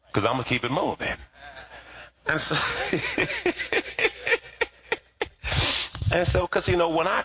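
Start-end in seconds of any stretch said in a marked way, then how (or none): 2.6-4.4 high laugh. Real, not acted. Preacher laughs at himself.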